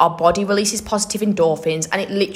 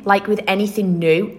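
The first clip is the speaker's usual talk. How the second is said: ordinary talk, nothing marked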